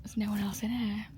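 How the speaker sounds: amazed hushed whisper; whispered disbelief. Real, not acted. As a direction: marvel quietly at the empty room. Hushed in a near-empty cinema.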